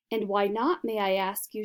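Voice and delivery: ordinary delivery, nothing marked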